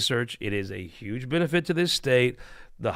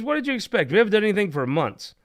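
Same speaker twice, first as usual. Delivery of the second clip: high, indignant protest, faster at the end; indignant husband; 8 semitones higher, faster than usual. Acted. Husband answering his angry wife, with high indignant protest. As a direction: throw his excuse back at her. Husband defends himself in joke.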